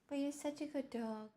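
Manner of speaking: talking to a dog. Real, not acted. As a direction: coo it to the dog.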